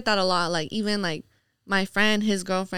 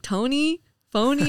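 higher, questioning "Tony? Phony?"; puzzled mishearing; 6 semitones higher than usual. Retold or imitated. Guest mimicking people who get her name wrong, puzzled. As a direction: mimic people unsure of the name, lifting each word.

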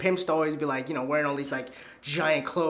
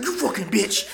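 imitated enraged pimp; put-on menace, slightly raised pitch; retold snarl from a cartoonish villain; mock angry outburst; 4 semitones higher than usual. Pimp's attack after door opens.

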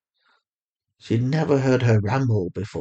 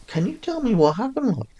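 higher mother voice, calm and steady; Fatima, soothing mother; 12 semitones higher than usual. A narrator's reading. A mother on the phone calmly reassuring her lost daughter.

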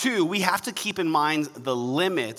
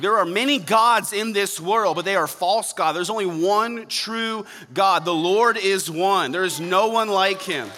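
louder, rising preacher proclamation; preaching at full force; 4 semitones higher, 4 dB louder than usual. Acted. Pastor declaring God's greatness to the church, full preaching. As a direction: proclaim it to the congregation with fire.

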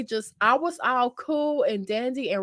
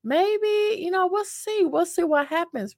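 a much higher, lilting maybe, we'll see; hopeful high voice; 11 semitones higher than usual. Retold or imitated. Telling viewers her pre-date hopes, in a high lilting voice. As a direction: float it up high, playing coy. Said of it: "I was like".

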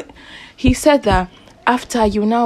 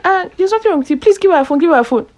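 mock lecturer; 9 semitones higher, faster than usual. Lecturer quoted ordering phone returned.

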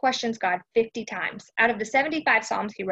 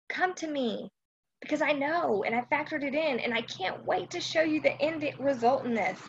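higher and much softer, gentle invitation; tender calling; 6 semitones higher, 4 dB quieter than usual. Acted. Preacher voicing God inviting weary listeners, soft and tender. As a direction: call them in close and tender. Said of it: "he's calling you".

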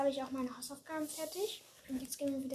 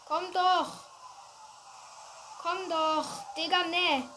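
higher, loud desperate urging; frantic shout; 4 semitones higher, 6 dB louder, slower than usual. Real, not acted. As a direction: yell at the car to come on. Chasing a car in game.

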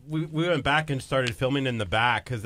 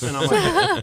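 excited; 7 dB louder, slower than usual. Retold or imitated. Excited retelling.

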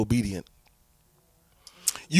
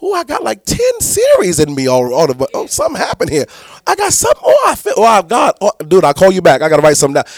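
higher, louder, bursting with sudden ideas; excited inspiration; 4 semitones higher, 5 dB louder than usual. Retold or imitated. Pastor reenacting a sudden sermon idea on the phone. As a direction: blurt it out like revelation just hit. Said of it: "I'll be like".

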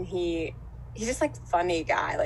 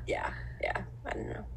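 whispering; 9 dB quieter than usual. Real, not acted. Whispered aside while laughing.

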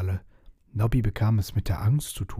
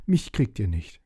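panting. Acted. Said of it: "jappte er".

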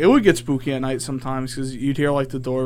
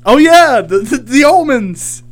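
high, loud burst of sudden remembering; excited outburst; 8 semitones higher, 7 dB louder than usual. Real, not acted. Host suddenly remembers a story for the show, excited. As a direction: exclaim it, much higher and louder.

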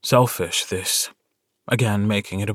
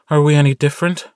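louder, higher bitter retort; 5 semitones higher, 7 dB louder, faster than usual. A narrator's reading. Elizabeth to Ox about his father, bitter and raised. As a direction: throw the question back with bitterness. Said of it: "she asks bitterly".

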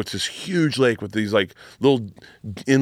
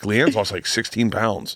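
normal talking voice

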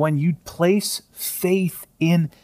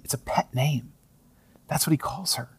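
hushed whisper, almost no voice; 7 dB quieter than usual. Real, not acted. Preacher whispering to the congregation about a pet name. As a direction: lean in and whisper it. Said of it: "whispered aside".

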